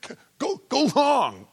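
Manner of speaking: high, loud call down the field; mock shouted signal to a receiver; yelling a play call. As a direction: bark the play out like a quarterback. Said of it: "You don't yell".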